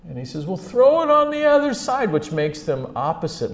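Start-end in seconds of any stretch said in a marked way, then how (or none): 0.4-2.1 high, loud shout across water; calling out. Acted. Jesus on shore directing the fishermen, loud high call. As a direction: holler instructions out to the boat.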